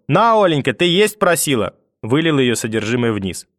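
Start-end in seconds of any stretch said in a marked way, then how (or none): 0.1-1.8 high, raised call; gloating cry. A narrator's reading. Grandmother pours food on mother from balcony, spiteful cry. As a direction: call out with spiteful mock generosity. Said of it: "с криком".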